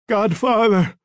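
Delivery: high-pitched cry of a dying man; tortured, pleading call; desperate calling out. A narrator's reading. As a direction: call out blindly for help. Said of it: "he called out blindly".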